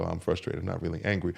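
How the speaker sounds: low, soft, lightly voiced denial; evasive guys. Guys avoiding admitting their anger, lightly voiced quiet denial. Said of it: "A lot of guys go".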